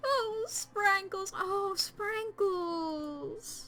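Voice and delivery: slow, high, drawn-out mock lament; hammed-up wailing. Mourners over the dead cat, hammed-up high wailing. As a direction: wail the cat's name theatrically.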